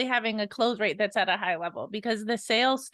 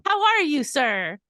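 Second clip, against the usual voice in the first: high, loud scripted "how are you"; mock a cold caller's canned greeting; imitated telemarketer, pitched up then dropping on "sir"; telemarketer reading script; 3 semitones higher, 5 dB louder than usual. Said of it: "you could tell when they're reading the script".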